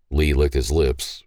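plain reading voice

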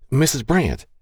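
lightly acted boy calling for someone. A narrator's reading. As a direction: call the name out high, without shouting. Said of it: "Troy Thomas called as he burst".

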